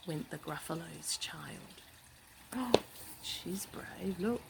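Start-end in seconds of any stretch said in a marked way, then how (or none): none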